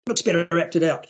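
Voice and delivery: usual speaking voice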